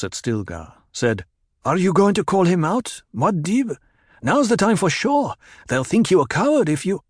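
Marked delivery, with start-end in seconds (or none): 1.6-7.0 higher, louder eager voice; pushing him to fight, bold and keen; goading young warrior. A narrator's reading. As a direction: companion urging Paul to call out Stilgar, eager and higher. Bold companion goads Paul.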